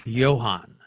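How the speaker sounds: "Johann" begun with a Y sound, as Yo-han; German Y sound. Shown as a demonstration. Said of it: "keeps the Y sound".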